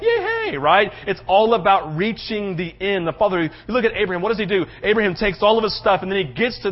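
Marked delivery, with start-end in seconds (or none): 0.0-0.6 high, giddy little cheer; mock an instant eager response; high-pitched hammed-up enthusiasm. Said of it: "an immediate response of like".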